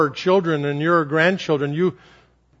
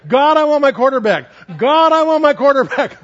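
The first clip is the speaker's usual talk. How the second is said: put-on high, louder child's yell at full swing; 8 semitones higher, 6 dB louder than usual. Little girl yelling to God at the top of the swing, high and louder. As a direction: call it out to God, louder each time. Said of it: "she was yelling".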